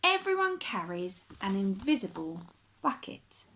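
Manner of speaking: plain reading voice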